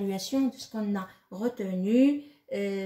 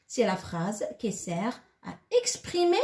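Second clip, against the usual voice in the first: ordinary delivery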